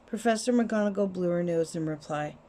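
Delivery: ordinary delivery, nothing marked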